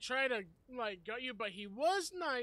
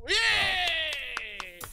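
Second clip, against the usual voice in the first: loud, high cheer of relief; 7 semitones higher, 10 dB louder than usual. Real, not acted. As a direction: cheer for the rescue. Cheering a teammate's survival.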